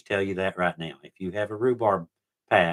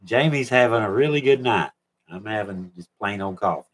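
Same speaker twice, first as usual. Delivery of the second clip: ordinary delivery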